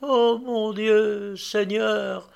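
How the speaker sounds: slow, high appeal to God; acted anguished invocation; imploring cry. A narrator's reading. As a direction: cry out a desperate prayer, drawn out. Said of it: "s'écria Annouchka en tombant à genoux".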